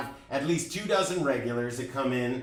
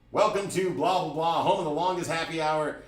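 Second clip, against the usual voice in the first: higher, louder mic-announcer sell; 5 semitones higher, 5 dB louder than usual. Shown as a demonstration. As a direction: pitch happy hour to the crowd like a club MC. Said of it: "It sounded good on the microphone".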